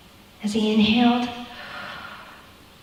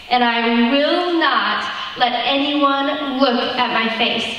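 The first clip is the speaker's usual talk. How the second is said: ordinary delivery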